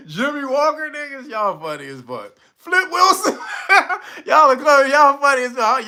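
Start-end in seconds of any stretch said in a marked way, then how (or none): 2.6-5.9 laughing. Real, not acted. Laughing at chat's jokes.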